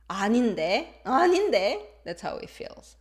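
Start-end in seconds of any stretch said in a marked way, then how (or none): 0.1-1.8 exaggerated confrontation. Shown as a demonstration. Teacher exaggerating how it feels, confrontational rising denial. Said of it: "I'm exaggerating".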